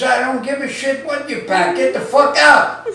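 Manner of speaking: high, snappy, laughing wife; wife dismissing him, laughing. Acted. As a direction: bark it out, high and cracking up. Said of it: "She says".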